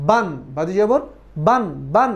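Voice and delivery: বান said with a clear ending n sound; tanwin pronunciation demo. Shown as a demonstration. Teacher showing students how বান ends in a nasal n. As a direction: stretch the নুন at the end of বান. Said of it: "শেষে একটি নুনের ভাব আসছে না?".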